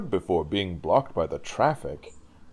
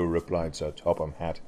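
ordinary delivery, nothing marked

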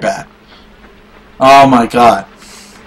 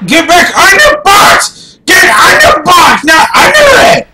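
higher shouted orders; raging shout; 8 semitones higher, 3 dB louder than usual. Real, not acted. Player screaming at his game character, angry shouts. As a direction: yell at the screen in rage. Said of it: "give me twenty minutes of me fucking raging".

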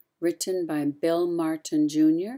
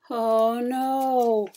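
mock dismay; 5 semitones higher, 3 dB louder than usual. Narrator reacts to the tree bending.

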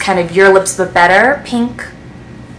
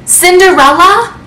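delighted surprise; 8 semitones higher than usual. Real, not acted. Recognising the Cinderella shade name.

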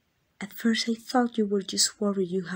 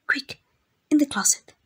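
sudden higher, louder urgent push; urgent hushed alarm; 7 semitones higher, 5 dB louder than usual. Acted. The royal hurrying the mage into the closet, urgent alarm. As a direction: hurry them into hiding, alarmed. Footsteps approach, hiding the lover.

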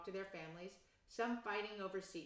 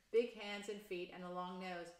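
plain reading voice

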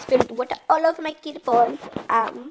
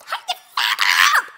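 mock tough voice; slower than usual. Playing in game, mock threat.

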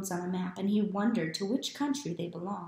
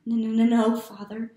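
stammering; 3 semitones higher, 9 dB louder than usual. A narrator's reading. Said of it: "stammered Neftali".

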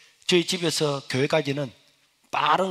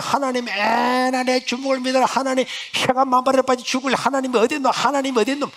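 defiant mocking; 9 semitones higher, 5 dB louder than usual. Mother mocking God as her son leaves, higher defiant taunt.

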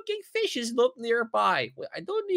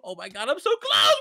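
ordinary delivery

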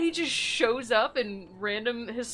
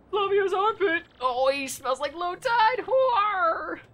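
loud, higher hammed-up gagging; mock disgusted yell; 10 semitones higher, 7 dB louder, slower than usual. A player teasing Flavio's smell with a loud yell. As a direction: shout the stink joke out.

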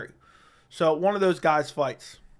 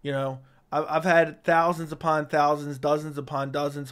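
usual speaking voice

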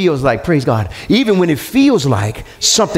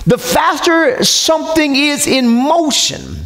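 high, driving sermon delivery; full-force preaching; 8 semitones higher than usual. Real, not acted. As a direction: preach it with rising intensity, pitch held high.

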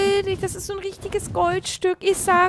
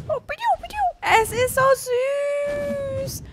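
cutesy cooing; 9 semitones higher than usual. Real, not acted. Player finds a creature so süß, cutesy cooing. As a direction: coo very high at an adorable little animal. Baby noises at cute creature.

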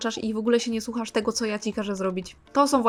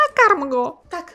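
exasperated shout; 6 semitones higher, 8 dB louder, slower than usual. Real, not acted. Player yelling feed him.

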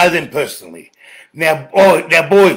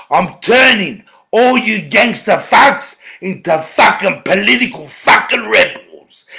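ordinary delivery

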